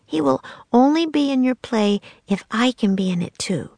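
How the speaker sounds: put-on a clever child striking a deal; bargaining girl. Annie bargaining with the man for a part, eager.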